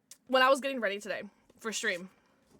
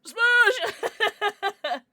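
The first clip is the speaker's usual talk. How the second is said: high, loud laughing "Smoosh!"; giddy laughing shout; playful squeal; 11 semitones higher, 10 dB louder than usual. Real, not acted. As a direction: squeal it like a kiss. Playful kiss noise to chat.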